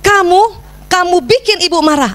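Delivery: put-on high-pitched blame thrown at the child; accusing scolding. Shown as a demonstration. Mother blaming her child for her anger, accusing scold. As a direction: hit KAMU high as an accusation. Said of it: "Banyak kita begini".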